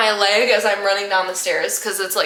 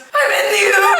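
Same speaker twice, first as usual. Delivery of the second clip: high, loud comic wail; mock crying; 12 semitones higher, 6 dB louder than usual. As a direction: blubber it out, hammed-up and laughing. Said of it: "and I'm crying".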